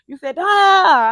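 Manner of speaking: very loud, very high, slow drawn-out mock yell; exaggerated yell. Coach retelling how he mimicked her, a loud mock yell. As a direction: blow it way out of proportion. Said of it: "Yesterday he said".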